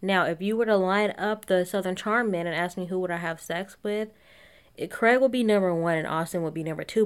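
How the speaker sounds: normal talking voice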